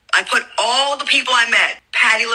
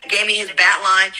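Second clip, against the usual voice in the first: normal talking voice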